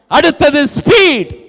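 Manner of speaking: high, loud, stretched-out heading; shouted announcement. Real, not acted. Preacher moving congregation to next point, shouted announcement. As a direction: call out the next point at full force.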